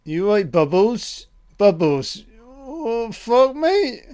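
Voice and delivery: jokey high-pitched put-on of a startled man; mock John. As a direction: play John spooked and squeaking out to the chimp. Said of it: "So John's like".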